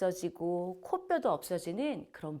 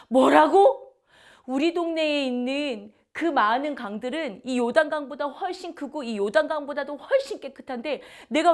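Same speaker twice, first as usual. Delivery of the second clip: loud, higher indignant outburst; angry general; 6 semitones higher, 8 dB louder than usual. Acted. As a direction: storm at the insult, loud and high. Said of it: "이렇게 화를 내는 거예요". (크고 높은 목소리로 화내며)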